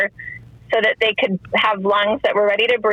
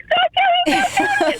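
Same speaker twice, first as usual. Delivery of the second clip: high, pleading cry through sobs, much higher pitch; retold sobbing plea. Mother at the ultrasound begging the doctor to stop counting, in a high tearful cry. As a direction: beg her to stop through tears, voice cracking up high. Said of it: "I'm sobbing even harder".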